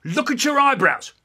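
high, loud sudden command; accusing dad. Acted. Dad catching son in a lie, accusing. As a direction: pounce on the lie. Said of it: "He says".

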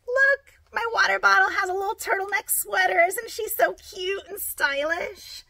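bright, high and gushing; excited. Real, not acted. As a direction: gush over something cute. Shows off cute bottle sweater.

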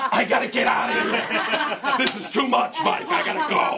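loud, high, frantic yelling; hammed-up overwhelmed parent shouting to escape; panicked concertgoer. Mother fleeing mellow concert in panic.

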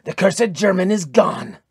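understated yell of frustration; mild yell. A narrator's reading. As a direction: call out the alarm. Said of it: "yelled Tyr".

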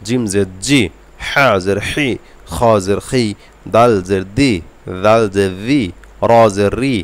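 slow, even drill of each letter with its kasra; Arabic letter drill. Shown as a demonstration. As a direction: recite each letter like a Quran teacher. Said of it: "তো আপনারা ইয়া পর্যন্ত পড়ে নেবেন". (আরবি হরফ মশকের উচ্চারণ)

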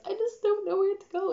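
very high mock-crying voice; upset wail. Lost traveller crying to airport workers, mock tearful wail. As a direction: wail it helplessly, sending yourself up. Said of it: "I'm really upset".

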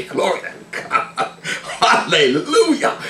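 slow praise through laughter; real chuckling, words stretched by laughter; laughing praise. Real, not acted. Laughing at own joke.

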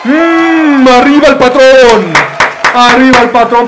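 high, loud, slow drawn cheer; triumphant cheer. Real, not acted. Streamer hails his boss in triumph, loud slow cheer. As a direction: cheer it out long and proud.

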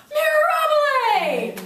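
very loud, high, stretched cheer; triumphant exclamation. Shown as a demonstration. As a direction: belt it out slowly in celebration. Demo of celebratory exclamation.

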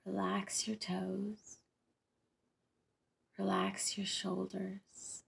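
slow, calm meditation delivery, unhurried pauses between phrases; guided meditation. Acted. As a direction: lead them gently through a visualization, drawing out key words. Leads the rainbow meditation.

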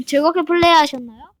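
mock host voice reading the end sign; announcer-style redo. Shown as a demonstration. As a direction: clear the throat and read it like a host. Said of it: "clears throat".

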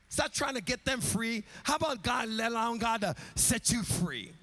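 loud, high, half-sung sermon climax; almost singing the altar call; climactic preaching. Acted. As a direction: build it up and shout the call to Jesus.

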